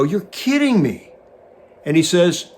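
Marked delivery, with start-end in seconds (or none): 0.0-1.1 high, mock-stunned reply; amazed disbelief. Pilot hears the cable guy now flies, high and amazed. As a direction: play yourself caught off guard by the news. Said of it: "And I go".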